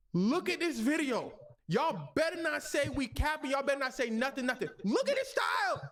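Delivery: high, insistent demand; indignant sister. Retold or imitated. Sister showing police the video, high insistent demand. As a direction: push the cops to act, voice up high.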